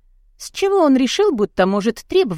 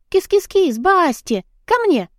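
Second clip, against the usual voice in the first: high-pitched kitty call; high coaxing summons; calling a cat; 10 semitones higher than usual. A narrator's reading. Heroine calls her cat.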